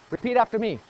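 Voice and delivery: ordinary delivery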